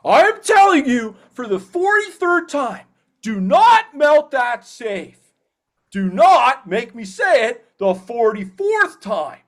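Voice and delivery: high, slow, loud cartoon voice for the safe warning; Wayne's voice. Voice actor reprises his character for a fan, high cartoon voice. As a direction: pitch way up and draw out the warning.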